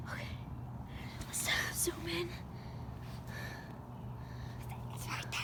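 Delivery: hushed breathless whisper. Pretend. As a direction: whisper while hiding. Hiding after the chase.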